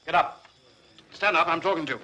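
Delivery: stern command. Acted. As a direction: order a slumped soldier to his feet. Officer rousing an unresponsive soldier.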